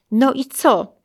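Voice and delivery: husband presses his wife for news, insistently; insistent question. Retold or imitated. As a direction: press for the answer.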